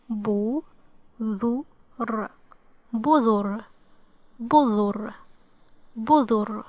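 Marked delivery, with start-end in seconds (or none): 0.1-2.3 Bu, dhu, r with pauses between; sounded out. Shown as a demonstration. Teacher helping learners try to read it, sound by sound. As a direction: separate each sound of the word. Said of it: "let's try to read it".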